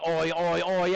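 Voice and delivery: middling Australian chant, pitch kept down; medium Aussie cheer. Pretend. Teacher rating the class's cheer as medium, half-hearted Aussie chant. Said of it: "There was a medium Aussie".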